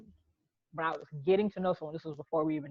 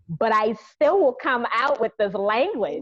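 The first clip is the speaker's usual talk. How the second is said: louder, higher, drawn out; emphatic warning; 5 semitones higher, 7 dB louder, slower than usual. Real, not acted. Warning that she can still switch into home talk, bold. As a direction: announce it boldly and slowly. Warns of her sassy Charleston talk.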